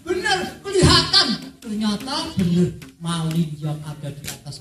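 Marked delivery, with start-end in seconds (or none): 0.0-1.3 laughing with joy at the sight; joyful laugh. Acted. Old mother spotting her son's ship, laughing happily. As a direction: burst out laughing, she sees him.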